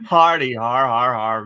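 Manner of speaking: flat sarcastic har har; mock a laugh dryly; sarcastic fake laugh.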